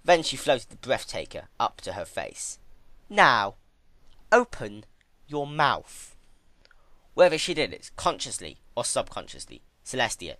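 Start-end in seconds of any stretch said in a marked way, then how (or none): 3.1-6.1 lightly voiced Twilight, slow and firm; a hint of cold command. Twilight ordering Celestia to open up, commanding. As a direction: order it sweetly but firm.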